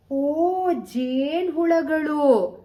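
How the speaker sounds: high, loud and slow, with wide-eyed wonder; a drawn-out gasp of delight; excited reveal. A narrator's reading. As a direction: unveil the surprise to little kids. Storyteller reveals bees to children.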